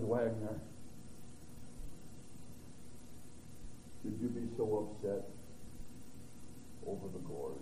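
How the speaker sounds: soft, low, hushed question; gentle soft rebuke. Acted. God gently chiding the preacher, soft and low. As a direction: ease it down, loving and hushed.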